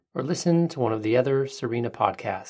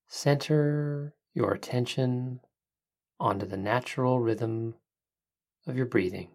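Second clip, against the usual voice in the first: soft spa whisper; 5 dB quieter than usual. Acted. Leads a breathing meditation.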